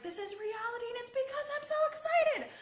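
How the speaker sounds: quoting the host's excited sweaty-pits line; imitating an excited host. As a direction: rise into a loud excited proclamation. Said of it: "She was just like".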